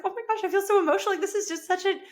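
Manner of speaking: high, fast, moved gushing; emotional excitement. Retold or imitated. Wife watching husband drive off, high and emotional. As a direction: gush while choked up with pride. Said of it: "this little choke up throat".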